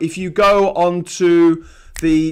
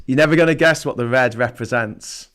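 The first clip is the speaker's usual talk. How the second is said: ordinary delivery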